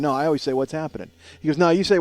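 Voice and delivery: normal talking voice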